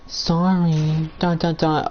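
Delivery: drawn-out, token sorry; singsong mock sorry; insincere apology. Shown as a demonstration. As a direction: toss out a hollow mock apology. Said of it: "not just saying".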